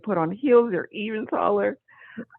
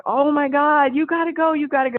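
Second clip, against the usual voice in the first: excited mom urging; 6 semitones higher, 4 dB louder than usual. Retold or imitated.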